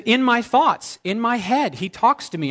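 excited insistence; fervent. Retold or imitated. The preacher telling the girl, high and fervent. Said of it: "I said".

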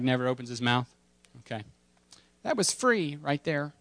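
ordinary talk

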